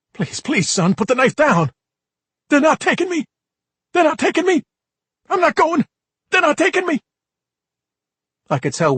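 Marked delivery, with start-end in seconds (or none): 0.1-1.7 higher, louder frantic begging; desperate plea. Acted. Father begs knife-wielding son, frantic and pleading. 2.5-7.0 much higher, louder hysterical shouting; frantic yelling. Acted. High son yells at his father, hysterical and shrill.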